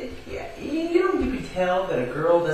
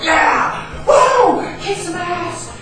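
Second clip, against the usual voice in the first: wild whooping yell, much higher and louder; screaming; 14 dB louder, much slower than usual. Retold or imitated. Hanging off the truck, screaming with joy. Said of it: "like, screaming".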